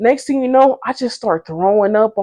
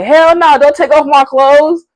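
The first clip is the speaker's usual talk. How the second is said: louder, higher hell no; loud refusal; 7 semitones higher, 5 dB louder than usual. Retold or imitated. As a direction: push the help away firmly. Refusing help undressing her.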